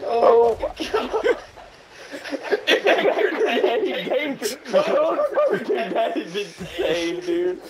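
high, excited laughing. Real, not acted. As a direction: laugh through the words.

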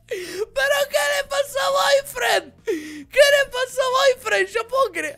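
high, loud, slow shriek of disbelief; amazed shriek. Real, not acted. Player sees her silly avatar face, shrieking in disbelief. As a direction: squeal at how ridiculous it looks.

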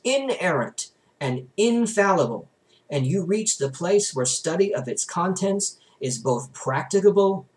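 steady, earnest reading of a religious text; book reading. A narrator's reading. Reader narrating a theology book to listeners, in an earnest reading voice. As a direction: read it aloud like a sermon from the page.

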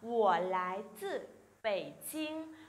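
slow, careful Mandarin question; slow, syllable by syllable Chinese demo; slow model reading. Shown as a demonstration. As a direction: read it out slowly for students to copy. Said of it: "Đọc lại nào".

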